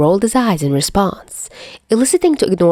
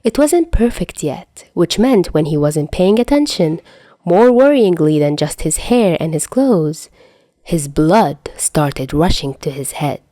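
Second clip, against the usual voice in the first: ordinary delivery, nothing marked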